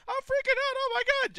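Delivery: very high, loud panicked squeal; mock panicking Cersei. Podcaster mocking Cersei's final scene, very high panicked squeal. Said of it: "She was all like".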